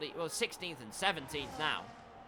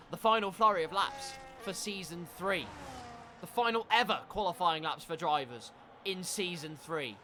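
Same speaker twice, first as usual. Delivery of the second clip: dramatic race commentary, building louder; live play-by-play; 2 semitones higher, 5 dB louder than usual. Real, not acted. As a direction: build up the final qualifying laps.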